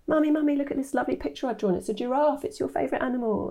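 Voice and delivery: lightly voiced eager child, high and fast; a hint of a seven-year-old showing off her drawing; little daughter. Daughter's words voiced in story.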